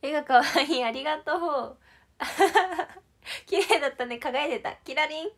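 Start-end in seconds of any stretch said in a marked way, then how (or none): 2.2-3.0 a real burst of high, loud laughter; happy giggle at a compliment; bright laugh. Real, not acted.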